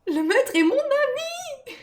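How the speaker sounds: high, slow creature protest; small, high creature voice; Gollum. Acted. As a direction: plead it high and drawn out.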